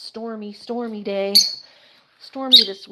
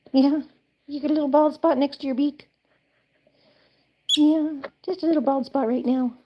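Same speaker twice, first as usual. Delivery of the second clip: pet talk; 5 semitones higher than usual. Real, not acted. Owner asking her bird about the bald spot in high pet talk.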